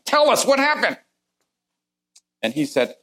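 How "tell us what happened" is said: high, loud demand at the captain; threatening demand. Retold or imitated. Passengers threatening the boat captain, high and loud demand. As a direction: force the truth out of him. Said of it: "we threatened him".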